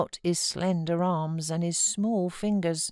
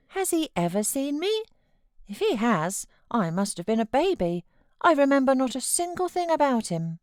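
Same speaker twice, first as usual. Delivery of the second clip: put-on wondering boy; 9 semitones higher, 3 dB louder than usual. A narrator's reading. Linton's voice.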